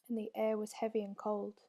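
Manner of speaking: ordinary delivery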